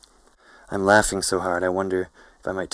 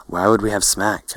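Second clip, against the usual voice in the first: ordinary delivery, nothing marked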